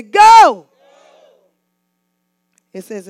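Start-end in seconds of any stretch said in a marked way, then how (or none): 0.0-0.7 huge high, drawn-out shout; full-force rallying yell; like you mean it. Shown as a demonstration. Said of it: "Act like you mean it".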